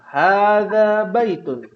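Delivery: teacher models the answer for the class to echo; slow model answer. Shown as a demonstration. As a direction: spell out "hadza baitun." slowly for the group. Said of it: "Ana akan bacakan".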